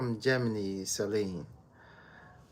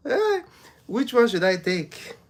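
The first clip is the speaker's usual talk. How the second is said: high, loud, laughing question; amused, giggly and louder; 12 semitones higher, 9 dB louder than usual. Real, not acted. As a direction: ask it through laughter. Laughing at a viewer's claimed heritage.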